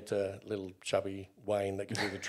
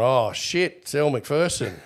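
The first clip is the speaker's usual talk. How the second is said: starstruck surprise; 3 semitones higher, 7 dB louder than usual. Retold or imitated. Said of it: "and I'm going".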